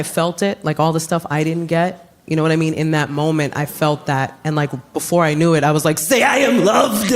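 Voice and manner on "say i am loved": slow, higher, shouted plea; desperate outburst. Retold or imitated. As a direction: blurt it out with overflowing need. Blurts out own unmet need.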